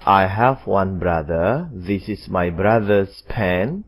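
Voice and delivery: slow, careful teacher's example; slow modelling voice; slow model reading. Shown as a demonstration. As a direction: spell it out slowly for the class. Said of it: "Kemudian nomor empat".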